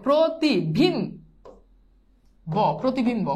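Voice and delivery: প্রতিবিম্ব slowly split as "pro, ti, bim, bo", then joined up; teacher sounding out প্রতিবিম্ব with a clear o in "pro"; syllable-by-syllable demo. Shown as a demonstration. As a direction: stretch out each syllable of প্রতিবিম্ব before saying it whole. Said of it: "এটা কিভাবে হয়েছে?".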